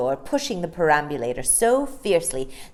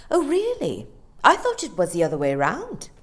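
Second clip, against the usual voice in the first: scornful; 4 semitones higher, 5 dB louder than usual. Acted. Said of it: "with a scornful laugh".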